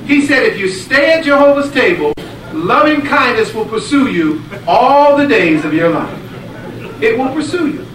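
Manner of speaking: high, loud, fervent preaching; full-force preaching. Acted. Preacher telling the blessing story to the congregation, at full force. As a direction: drive it home to the congregation.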